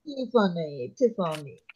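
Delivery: lightly echoed mock Arabic accent; imitated Arabic accent. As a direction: copy the boss's Arabic accent quietly. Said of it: "That's how he says it".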